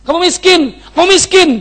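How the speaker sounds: high, rising repeated rebuke; God rebuking. Retold or imitated. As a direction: voice God's stern verdict. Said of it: "Tuhan bilang gini". (suara Tuhan yang menegur keras)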